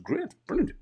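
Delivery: high-pitched mock enthusiasm; retold gushing approval; mock delight. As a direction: agree far too eagerly, acting it out. Retold over-bright agreement, sarcastic customer.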